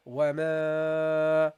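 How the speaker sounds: slow, drawn-out recitation of وما; Quranic recitation. Shown as a demonstration. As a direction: recite وما slowly with a long aa. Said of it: "You say".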